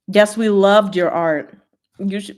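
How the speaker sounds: ordinary delivery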